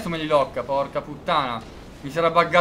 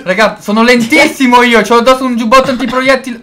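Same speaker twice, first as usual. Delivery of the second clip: higher, loud complaint; loud protest; 6 semitones higher, 7 dB louder than usual. Real, not acted. Slowed player defends himself to teammates, loudly protesting. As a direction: protest at full volume to the team.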